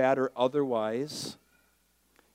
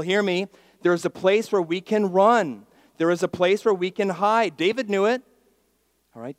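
loud, high call to the congregation; full-force preaching; 6 semitones higher, 8 dB louder than usual. Acted. Pastor leading the church in response, preaching loud. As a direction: preach it out and pull them in.

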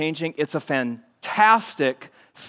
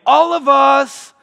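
much higher, much louder sermon peak; soaring preaching; 12 semitones higher, 10 dB louder than usual. Acted. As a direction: lift them with a final cry. Preaching climax.

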